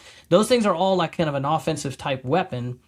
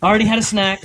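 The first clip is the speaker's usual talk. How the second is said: loud jokey echo; 6 semitones higher, 6 dB louder, faster than usual. Real, not acted. Speaker echoing a listener's joke to the room, loudly teasing. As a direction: repeat it louder and higher, teasing.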